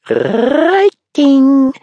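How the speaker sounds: a tiny sprite yelling with glee; gleeful shout. Acted. Blink the sprite teasing Frederick, gleeful high shout. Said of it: "Blink shouted gleefully".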